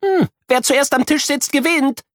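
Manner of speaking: high cheeky race call; mock yell. A narrator's reading. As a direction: race challenge called out gleefully. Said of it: "schreit Kuno".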